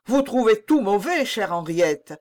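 loud, high, disgruntled complaint; displeased. A narrator's reading. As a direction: complain to her in annoyance. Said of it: "dit Louis mécontent".

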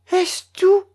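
high, tense question holding back fear; sharp rise in pitch, terror held in; contained terror. A narrator's reading. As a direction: force calm over terror. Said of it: "reprit Mélanie avec calme et en contenant sa terreur".

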